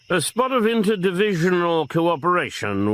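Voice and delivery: a fellow superintendent asking a favour on the phone; Superintendent Conley. A narrator's reading.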